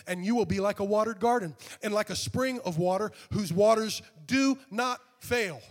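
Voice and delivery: high and loud, slowing hard on the last words; preaching scripture. Acted. Scripture reading climax.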